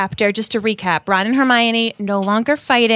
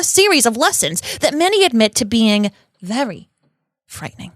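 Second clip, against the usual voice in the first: dramatic New York gossip read; Rita Skeeter. Acted. Rita accusing Hagrid in her article, New York accent. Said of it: "Rita has a New York accent".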